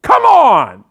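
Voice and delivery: loud, high shouted "Come on!"; angry outburst. Acted. To the flight attendant who hip-checked him, loud exasperated yell. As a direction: explode at the line-skipper. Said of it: "something us Canadians should never say".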